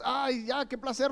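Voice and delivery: high airy mock pleasure; mock sinner's delight. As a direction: lampoon the careless sinner. (imitación burlona del pecador, aguda)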